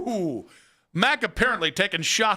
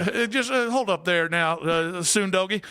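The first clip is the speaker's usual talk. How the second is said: southern twang; 3 semitones higher than usual. Acted. Radio host hams a Southern twang for the caller. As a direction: play a Southern charmer, lightly. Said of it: "southern, just definitely southern twang".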